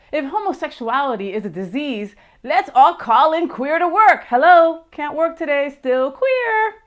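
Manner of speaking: a touch of a mock call-in-sick voice; hammed-up phone call, rising to the joke; Robin Tyler impression. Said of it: "That was Robin Tyler".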